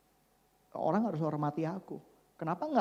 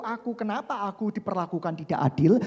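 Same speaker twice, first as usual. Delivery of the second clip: usual speaking voice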